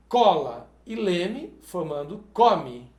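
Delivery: slow, each word laid out for students; deliberate classroom dictation; slow teaching repeat. Shown as a demonstration. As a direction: spell it out for the class slowly. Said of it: "teacher repeats slowly".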